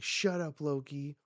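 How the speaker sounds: high, loud mock yell; acted angry scolding. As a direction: cut him off sharply, in character.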